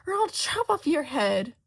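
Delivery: high, loud raging threat; queen shrieking a beheading threat; furious threat. A narrator's reading. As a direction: threaten her head off. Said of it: "the queen shrieks".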